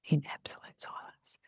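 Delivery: unvoiced whisper trailing to nothing; dramatic whisper. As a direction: whisper it to make the silence felt. Dramatic hush for silence.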